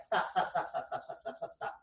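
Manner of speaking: rapid-fire whispered staccato repeats; mimicked freeze-frame stutter. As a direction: whisper it like frames clicking past, fading away. Imitating freeze-frame video motion.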